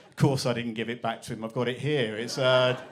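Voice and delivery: usual speaking voice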